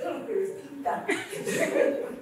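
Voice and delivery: drawn-out, laughing "Oh, Peter, Peter" in a put-on sweet accent; mock American Peter. Shown as a demonstration. Pastor mocking the American-sounding pastors, laughing put-on accent. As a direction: stretch "Peter" slowly like an American, half laughing.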